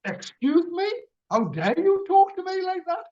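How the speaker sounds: high-pitched re-enacted outrage; indignant. Customer challenges worker's tone, indignant. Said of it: "when I've gone".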